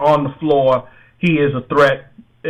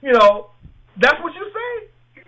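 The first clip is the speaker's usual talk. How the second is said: high, disbelieving outcry; stunned disbelief, pitch soaring; incredulous; 9 semitones higher than usual. Real, not acted. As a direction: voice shoots up in shock. Disbelief at LeBron's remark.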